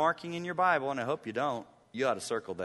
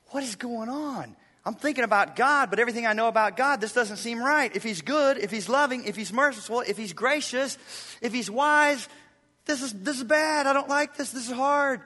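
higher, louder anguished questions; troubled psalmist; 8 semitones higher, 4 dB louder than usual. Retold or imitated. Pastor voicing the psalmist's troubled thoughts, anguished. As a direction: voice the psalmist's inner distress. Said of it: "It caused him to be troubled".

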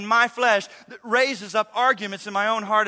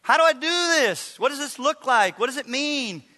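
imitating a pleading congregant; 6 semitones higher, 4 dB louder than usual. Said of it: "had a number of you say".

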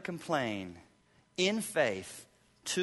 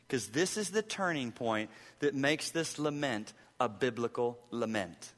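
ordinary talk

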